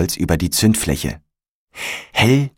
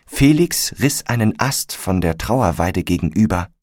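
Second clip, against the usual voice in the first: ordinary delivery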